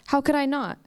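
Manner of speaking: ordinary delivery, nothing marked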